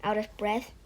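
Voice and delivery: plain reading voice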